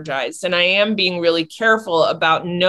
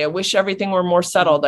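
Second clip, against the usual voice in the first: normal talking voice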